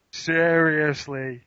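very slow, stretched "Seriously"; slow, exaggerated "Seriously"; drawn-out insistence. Real, not acted. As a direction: drag out every syllable of "Seriously". Playing up a repeated catchword.

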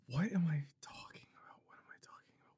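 unvoiced whisper, confused; lost the thread, whispering; whispering to self. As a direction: mutter it under the breath.

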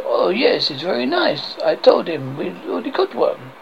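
mock French guard accent; outrageous French accent. French guard brags about the grail, outrageous French accent. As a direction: boast in a silly French accent. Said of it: "I have this outrageous accent".

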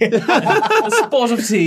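slow, high mock British accent; a spot of tea in fake British; posh British accent. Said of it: "English headcanon".

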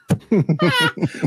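high laughing burst; burst of laughter. Real, not acted. Laughs at mask story.

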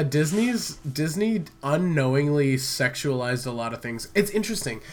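normal talking voice

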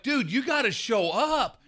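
high, slow, drawn-out coaxing; urging a buddy. Retold or imitated. Telling the timid yeller behind him, mock exhortation. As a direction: push him to step it up. Urging the weak caller to improve.

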